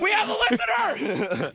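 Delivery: high, laughing delight; excited. Real, not acted. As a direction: squeal it out in disbelief. Thrilled at having a listener.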